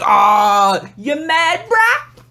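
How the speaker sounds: very high sing-song goading; exaggerated high-pitched needling; mocking taunt. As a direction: tease in a squeaky, stretched-out voice. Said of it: "People are gonna think you're serious. They're like".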